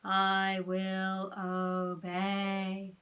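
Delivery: very slow monotone robot; flat mechanical obedience, word by word. A narrator's reading. Ralph plays hypnotised, very slow robot voice. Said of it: "said Ralph in a robot voice".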